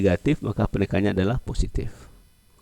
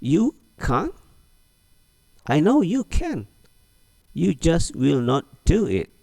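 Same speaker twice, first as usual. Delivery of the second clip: high, slow teasing disbelief; high, slowed teasing reply drilled again; repeated model reading; 8 semitones higher, slower than usual. Shown as a demonstration. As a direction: drill it once more. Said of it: "Ulang lagi".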